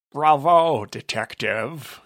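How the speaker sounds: sly, drawn-out greeting. Acted. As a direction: savour the compliment slyly. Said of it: "Pretzel Gentleman said slyly".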